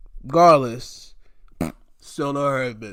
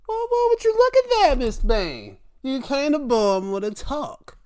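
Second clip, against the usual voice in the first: high, loud put-on surprise; playful exaggerated wonder; mock amazement; 11 semitones higher, 3 dB louder than usual. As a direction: marvel teasingly, starting way up high. Acts amazed reaction to Bayne.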